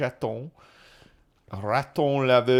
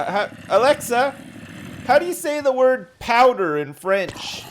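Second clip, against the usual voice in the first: raised voice to the smart speaker, high and loud; calling out; 10 semitones higher, 5 dB louder than usual. Real, not acted. Man calling out to Alexa for a translation, loud. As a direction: call across the room to Alexa.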